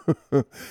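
short chuckle; amused laugh at own joke. Real, not acted. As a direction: giggle briefly at your own silliness.

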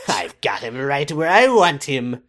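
evil schemer. Retold or imitated. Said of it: "evil laughs to herself".

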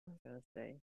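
barely audible self-question; muttering to herself. Real, not acted. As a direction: mumble it under your breath. Losing her train of thought.

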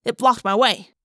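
usual speaking voice

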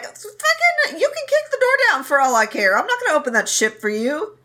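shrill fast refusal through the door; angry shrill retort. Retold or imitated. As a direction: fire it back in a high angry rush. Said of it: "and Ah Ching's like".

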